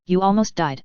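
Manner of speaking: plain reading voice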